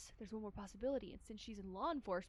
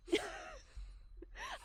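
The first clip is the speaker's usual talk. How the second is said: high cackle. Acted. High laugh after line.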